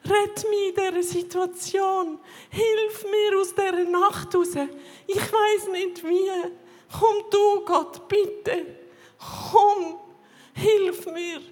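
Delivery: crying out to God from the floor; desperate cry to God. Retold or imitated. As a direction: beg God for help as the voice rises. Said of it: "habe so zu Gott geschrauen".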